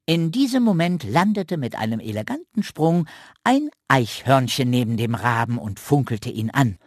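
ordinary delivery, nothing marked

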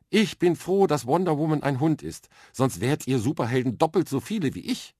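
put-on a confident little girl explaining her plan; lively young girl. Girl with a bucket on her head explains space training to Arthur, eager and matter-of-fact. Mia speaks to the dog.

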